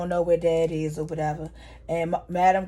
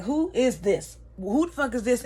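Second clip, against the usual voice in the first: higher-pitched, indignant questioning; retold angry demand; 4 semitones higher than usual. Madam confronting an unknown caller, indignant. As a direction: demand an answer, mildly heated.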